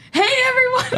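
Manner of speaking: high, over-bright hello to the room; manic host greeting. Retold or imitated. Friend imitating a manic comedy host, high cheery greeting. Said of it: "You're just manic, like".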